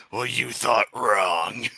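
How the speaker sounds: slow, smug villain voice; smug villain reply. Retold or imitated. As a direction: drawl it out like a gloating villain. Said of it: "reply in troll voice".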